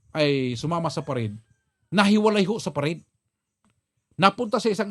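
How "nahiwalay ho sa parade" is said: ordinary talk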